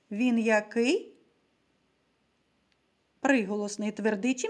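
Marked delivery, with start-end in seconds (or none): none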